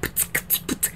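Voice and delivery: voiceless spitting beatbox noises; imitated beatboxing. Imitating a TV talent's joke act, beatboxing. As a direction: imitate a beatbox with lips and breath. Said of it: "he'd be beatboxing".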